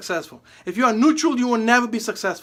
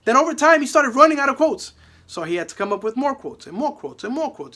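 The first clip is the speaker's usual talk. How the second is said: ordinary talk, nothing marked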